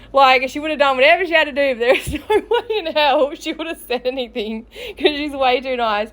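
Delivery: real laughter breaking into the words; laughing while talking. Real, not acted. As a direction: try to talk through the giggles. Cracking up at imagined date.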